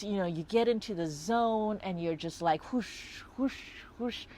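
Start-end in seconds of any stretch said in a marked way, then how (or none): 2.7-4.3 airy, voiceless swooshing noises; imitated whoosh. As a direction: whoosh like sweeping through curves. Imitates gliding through twisty roads.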